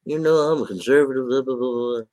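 a hint of a smug conservative; mock self-important conservative. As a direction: lightly parody the excuse-maker. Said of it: "I'm not saying".

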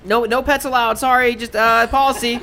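high, loud and flustered; flustered shopkeeper. Acted. Gemini flustered, shooing the spider man and his dog. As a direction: get rid of them quickly.

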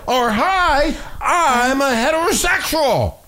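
high, drawn-out mock declaration; mock announcement. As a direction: stretch it out in a high, mocking voice.

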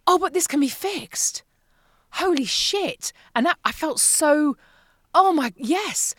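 high, slow mock relief and joy; light up as the fix dawns; retold elation. Realising menopause can be fixed, mild elation. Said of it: "And then I thought".

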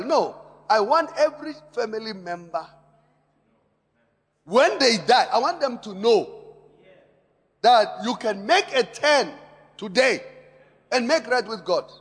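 loud, urgent sermon delivery; forceful preaching. Acted. Pastor warning the church about hell, loud and urgent. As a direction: drive it home to the congregation.

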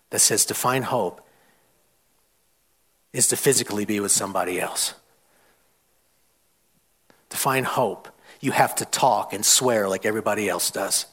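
low, soft, earnest appeal; hushed plea. Real, not acted. Preacher pleads with young people about hope, hushed and earnest. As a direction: bring it right down, with heavy pauses.

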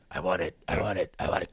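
low creepy murmur; creepy old man voice. Retold or imitated. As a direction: whisper it like a creeping ghost.

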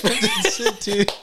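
after laughing hard. Real, not acted. After big laughing fit.

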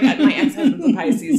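high and loud, laughing; mock retort. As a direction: fire back with a laughing comeback. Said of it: "And I'm like".

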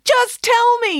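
very high, loud outburst; exasperated yell. A narrator's reading. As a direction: explode with impatience. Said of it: "yelled the vice principal".

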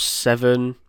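ordinary delivery

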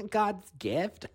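high-pitched imitated cackling around the words; hammed-up laughing disbelief; mock derisive laughing. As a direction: imitate a snarky clerk laughing in someone's face. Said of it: "is laughing at me".

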